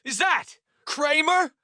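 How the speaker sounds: high, slow, disbelieving question; disbelief. A narrator's reading. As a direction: draw it out as if you can't believe it. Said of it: "in disbelief".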